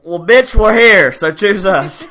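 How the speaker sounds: high, loud yell breaking into a laugh; excited yelling. Real, not acted. Host urging listeners to choose them, excited yelling. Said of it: "Can you stop yelling".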